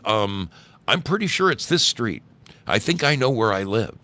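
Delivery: mildly snippy correction; rude. A narrator's reading. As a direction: contradict with a touch of rudeness. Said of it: "but I very rudely said".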